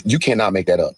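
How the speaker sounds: usual speaking voice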